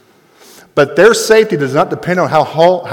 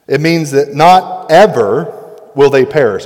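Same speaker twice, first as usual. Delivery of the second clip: usual speaking voice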